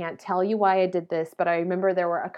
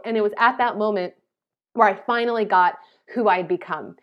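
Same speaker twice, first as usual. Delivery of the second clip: ordinary talk, nothing marked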